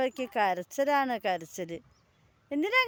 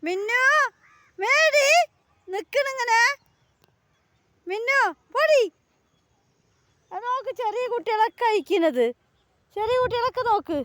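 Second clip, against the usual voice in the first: high coaxing pet talk; 6 semitones higher than usual. Real, not acted. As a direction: urge the little one to come eat.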